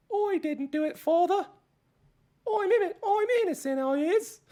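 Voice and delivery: put-on very high squeaky British boy; young British lad. Co-host doing the phrase they both do, young British lad. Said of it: "the thing we say in that voice".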